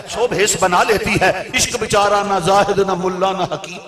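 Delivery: loud impassioned sermon voice; fiery preaching. Acted. Preacher rousing the gathering on love and reason, fiery. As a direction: drive the words at the congregation.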